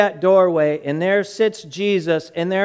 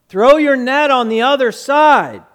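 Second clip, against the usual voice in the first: loud, higher call across water; yelling out; 6 semitones higher, 5 dB louder than usual. Retold or imitated. Jesus to disciples fishing in a boat, yelling from shore. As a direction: holler it from a distance. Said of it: "He yells out from shore".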